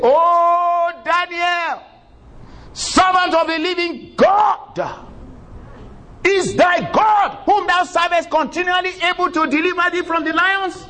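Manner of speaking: high, slow anguished calling out; lamenting shout. A narrator's reading. King calling down into the den, lamenting shout. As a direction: cry out desperately to the pit. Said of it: "he lamented in a voice unto Daniel".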